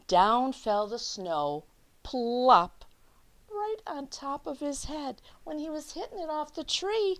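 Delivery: lively picture-book read-aloud; reading to children. Acted. Teacher reading The Snowy Day to her class, lively read-aloud. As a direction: read it out like story time for little kids.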